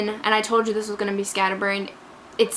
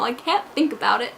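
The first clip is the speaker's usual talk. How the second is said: ordinary talk, nothing marked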